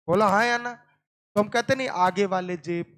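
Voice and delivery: ordinary talk, nothing marked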